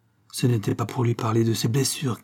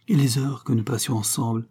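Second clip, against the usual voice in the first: usual speaking voice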